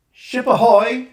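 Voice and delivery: loud, high hail across a field; calling out. Acted. Mother hails Roger down the field, high loud call. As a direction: sing out the call like a sailor.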